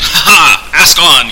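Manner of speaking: loud, hearty acted laugh into words; laughing aloud. A narrator's reading. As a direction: laugh out, then grandly invite the question. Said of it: "Pendulum laughed aloud".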